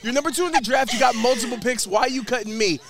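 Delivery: high, loud, hammed-up indignation; mock emotional. Host ranting at a team that cut the line, mock emotional. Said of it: "I'm getting emotional".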